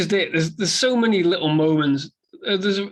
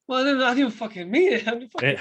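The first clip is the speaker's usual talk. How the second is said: fast, higher, laughing excuse; flustered backpedaling; 9 semitones higher, faster than usual. Retold or imitated. Co-host voicing the bar guy backpedaling, fast and laughing. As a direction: babble an excuse while laughing. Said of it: "He's like".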